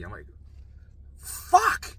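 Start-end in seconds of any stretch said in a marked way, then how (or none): 1.2-2.0 high, loud burst of exasperation; frustrated scream. Retold or imitated. Patient left holding his car key, frustrated scream. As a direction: scream the curse out.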